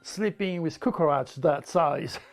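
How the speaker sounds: ordinary talk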